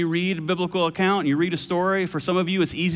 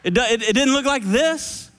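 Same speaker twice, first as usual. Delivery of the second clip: ordinary talk, nothing marked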